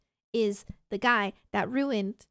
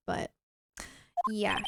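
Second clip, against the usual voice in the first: ordinary delivery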